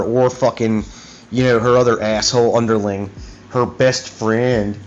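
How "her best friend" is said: normal talking voice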